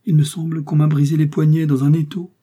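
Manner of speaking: high, loud complaint; pained complaint. A narrator's reading. Complains of crushed wrists.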